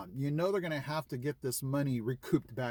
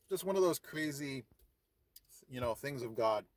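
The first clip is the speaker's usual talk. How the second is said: normal talking voice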